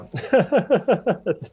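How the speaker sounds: a quick amused laugh, breaking high; a short laugh. Real, not acted. The interviewer laughs at the Fragile joke, amused laugh. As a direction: laugh lightly at the answer.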